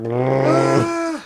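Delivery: sudden loud, high mechanical roar; computer noise imitation. Man imitating his idle computer suddenly roaring, loud droning noise. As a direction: mimic a computer fan flaring up. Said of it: "it's just like".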